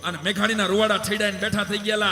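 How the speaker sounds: loud, high, declaiming storyteller over music; folk stage recitation. Acted. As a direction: hold the whole ballad at full stage force.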